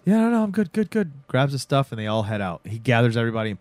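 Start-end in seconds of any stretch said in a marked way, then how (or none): 0.1-1.1 high-pitched, rattled insistence; nervous reassurance. Acted. Rattled man answering the suspicious vampire, nervous.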